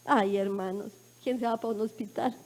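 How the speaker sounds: low, soft, heavy aside; hushed, low-pitched lament to the congregation; subdued dread. Real, not acted. Sorrowful aside about going to hospital.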